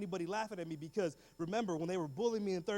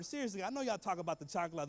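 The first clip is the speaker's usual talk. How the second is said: ordinary talk, nothing marked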